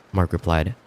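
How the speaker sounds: ordinary delivery, nothing marked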